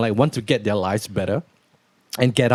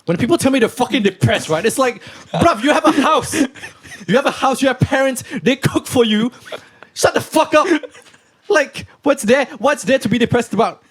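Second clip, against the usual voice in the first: Andrew Tate manner; 7 semitones higher than usual. Pretend. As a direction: rant like a tough-love influencer who has no patience. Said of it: "let's do it in the Andrew Tate manner".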